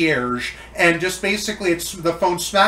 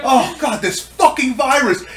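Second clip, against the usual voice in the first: much higher, louder, slowed screaming chef with laughter; Gordon Ramsay impression; 11 semitones higher, 10 dB louder than usual. Friend yells at the virus as a TV chef, laughing. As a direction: berate it like a furious celebrity chef. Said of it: "Gordon Ramsay thinks of this".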